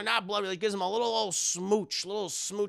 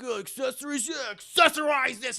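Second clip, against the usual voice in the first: higher, put-on lewd hobo voice; sleazy punchline, higher pitched; crude hobo; 5 semitones higher than usual. Hobo answers the shopper's question with a higher, lewd put-on voice. Hobo's crude reply, retold.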